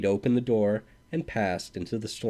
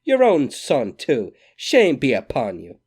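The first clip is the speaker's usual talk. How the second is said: loud, higher reproachful cry; 4 semitones higher, 7 dB louder than usual. A narrator's reading. Mother shaming old Scully, loud high cry. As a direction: scold him loudly from the heart. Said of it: "she cried".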